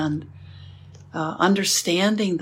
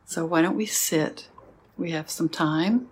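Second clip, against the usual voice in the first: guided meditation; 4 dB quieter than usual. Acted. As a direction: guide the sitting gently, with long pauses. Leads a sitting meditation.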